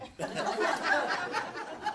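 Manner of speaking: airy unvoiced laugh, high; breathy laugh. Real, not acted. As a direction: let a silent wheezing laugh escape. Laughing at own punchline.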